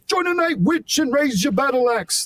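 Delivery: cartoon-high rock shout-talk. Shown as a demonstration. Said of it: "[name] kind of thing".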